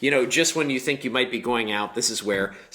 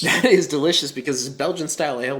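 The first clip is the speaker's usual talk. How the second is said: laughing; 5 semitones higher, 3 dB louder, faster than usual. Real, not acted. Echoing the whisper louder.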